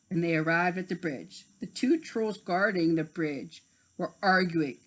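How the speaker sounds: plain reading voice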